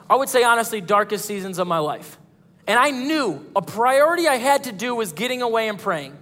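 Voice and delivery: usual speaking voice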